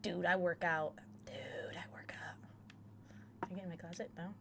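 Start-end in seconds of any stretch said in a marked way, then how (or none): 1.2-2.4 low, soft, breath-heavy repeat; mock-cool hushed aside; hushed boast. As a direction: drop it down to a near-whisper.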